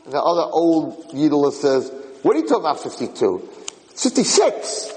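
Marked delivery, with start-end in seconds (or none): 2.2-4.9 mock squabble, leaping up high; arguing old porch-sitter. Third old porch-sitter disputing the count, high mock squabble. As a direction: challenge them, then pitch the correction high and long. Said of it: "they're all fighting there".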